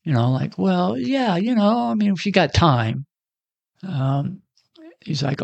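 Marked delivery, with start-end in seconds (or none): 0.6-3.0 high, casual put-on nonchalance; acting unbothered, breezy and high; faking cool. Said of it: "I tried to act cool".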